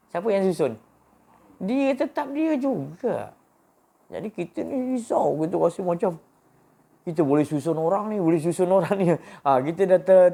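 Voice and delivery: rising, loud preacher's climax; preaching at full force. Acted. Preacher stirring the congregation, loud and high.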